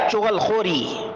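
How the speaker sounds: usual speaking voice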